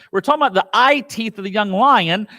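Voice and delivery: ordinary talk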